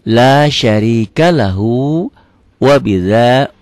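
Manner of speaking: slow, repeated model phrases with long vowels; slow recitation. Shown as a demonstration. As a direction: recite slowly, pausing for learners to follow. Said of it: "perlahan ya".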